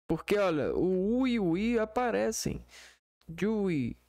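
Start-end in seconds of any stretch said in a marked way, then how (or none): none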